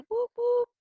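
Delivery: high, whooping hype call; excited cheer. Real, not acted. Host cheering for Money Heist, excited whoop. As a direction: cheer it like a fan.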